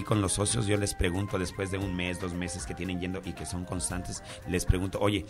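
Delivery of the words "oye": ordinary talk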